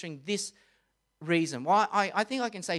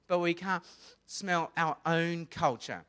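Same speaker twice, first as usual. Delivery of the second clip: ordinary talk, nothing marked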